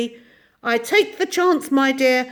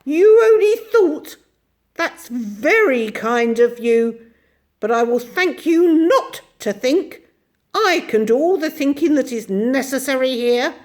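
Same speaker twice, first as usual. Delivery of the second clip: soft and terrible; 7 semitones higher, 9 dB louder than usual. Acted. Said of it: "in her soft, terrible voice".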